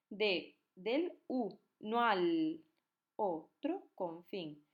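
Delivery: slow, each syllable set apart. Shown as a demonstration. Teacher splitting the verse for students before counting. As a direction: split "De, del, u, no al otro, con fin" into syllables. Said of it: "Hacemos sinalefa".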